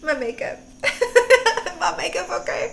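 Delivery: high, tearful laughter; squeaky laughing words; laughing through tears. Real, not acted. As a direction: laugh helplessly while moved to tears. Laughs through tears about makeup.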